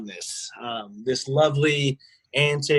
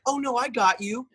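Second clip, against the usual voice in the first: higher-pitched, put-on helpful voice; mock obliging; 9 semitones higher than usual. Manager answers staff who want a swipe, mock obliging. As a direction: imitate an eager people-pleaser.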